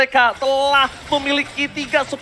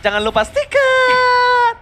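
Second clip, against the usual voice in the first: high squeaky voice; 12 semitones higher, 4 dB louder, slower than usual. Pretend. Repeating sticker plug in high voice.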